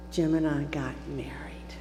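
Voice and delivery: softly whispered payoff; hushed whisper. Real, not acted. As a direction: breathe it out like a secret.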